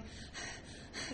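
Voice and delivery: acted out-of-breath panting; heavy breathing. Runner too winded to answer, heavy breathing. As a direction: pant as if winded from running. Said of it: "like, breathing heavy".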